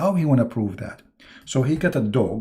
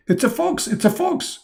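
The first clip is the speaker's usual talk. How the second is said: much higher, squeaky mock voice; Adam answering. Adam repeating God's answer to the angels in a squeaky mock voice. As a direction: parrot the answer eagerly twice. Tell them.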